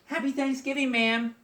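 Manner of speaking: high, sing-out holiday greeting; bookstore greeting. Acted. Acts bookstore exchange in monologue.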